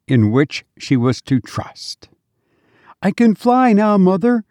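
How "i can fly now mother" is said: put-on high, sweet little child calling his mother; a small dead child greeting his mother with joy; child's voice. A narrator's reading. Dead child to his weeping mother, high sweet child voice. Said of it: "said the child".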